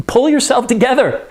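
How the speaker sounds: high-pitched mock scolding; exasperated urging. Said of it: "So she's like".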